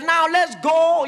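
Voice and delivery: slow loud high call to charge; battle cry. Acted. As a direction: send the troops charging. Commander orders the charge.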